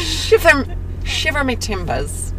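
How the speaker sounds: bad Irish accent attempt. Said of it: "how does one do an Irish accent?".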